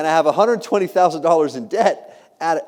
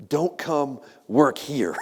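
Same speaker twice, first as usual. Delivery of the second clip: very slow, spaced-out warning; leaning-in warning; 3 dB quieter, much slower than usual. Retold or imitated. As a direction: draw out each word like grave secret advice. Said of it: "he leans in, and he goes".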